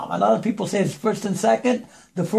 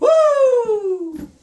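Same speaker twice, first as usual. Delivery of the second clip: whoop; 5 dB louder than usual. Real, not acted. Warming up before recording.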